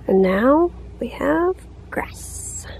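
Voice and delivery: soft pleased whisper. Real, not acted. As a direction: hush it, proud and pleased. Proud soft ending.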